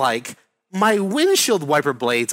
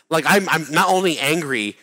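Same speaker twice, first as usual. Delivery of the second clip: ordinary talk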